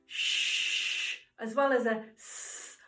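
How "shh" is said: shh as a long breathy hiss; shh sound. Shown as a demonstration. Teacher showing the shh out-breath. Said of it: "breathe out with a".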